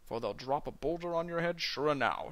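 Scottish dwarf saying "sure enow" with a rolled burr; Sean Connery impression. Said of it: "do a Sean Connery impression".

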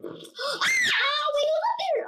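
high-pitched gasp and cry; gasp, then call out high in alarm; startled alarm. Real, not acted. Player spotting someone up there, high startled cry.

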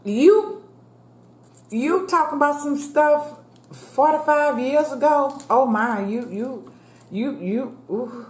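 high, scornful "you a fool" voice; mocking disbelief. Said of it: "And I'm thinking about".

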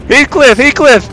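loud high call across the field; calling out loudly. Pretend. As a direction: shout the name after him. Calling the fleeing medic.

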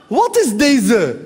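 very slow street drawl "Wat is deze"; Moroccan Dutch street. Retold or imitated. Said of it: "klassieke Marokkaanse straattaalreactie".

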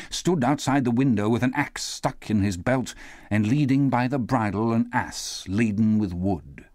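usual speaking voice

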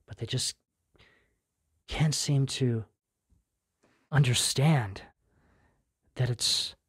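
lightly voiced sad moon, softly lamenting; voicing the sad moon. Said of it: "looks really sad".

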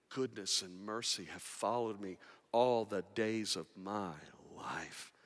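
a soft, sinking, tender prayer; hushed prayer. Retold or imitated. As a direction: pray it quietly, fading at the end. Quoted prayer of trust.

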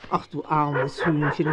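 usual speaking voice

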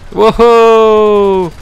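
loud, high cheer; excited victory whoop; gleeful whoop. Real, not acted. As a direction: let out a triumphant yell. Launches a nuke in game.

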